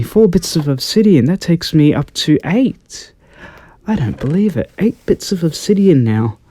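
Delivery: high-pitched, amazed excitement; can barely believe your luck; excited. Real, not acted. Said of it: "I'm a little bit excited".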